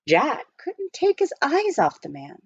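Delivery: ordinary delivery, nothing marked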